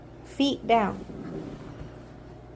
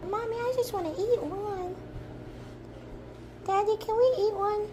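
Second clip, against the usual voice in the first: higher, begging pup; high dog voice; 11 semitones higher, 4 dB quieter than usual. Pretend. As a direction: plead like a hungry puppy. Owner voicing the dog.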